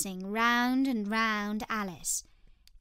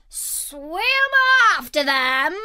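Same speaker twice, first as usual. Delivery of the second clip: high-pitched excited scream; wild creature's loud cry; 7 semitones higher, 9 dB louder, much slower than usual. A narrator's reading. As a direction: shriek the order, swooping from high. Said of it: "screamed the Gryphon".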